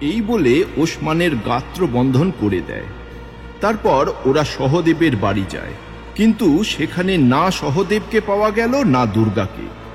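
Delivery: usual speaking voice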